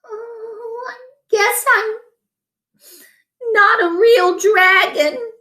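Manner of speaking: sad thought. A narrator's reading. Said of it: "Crispin thought".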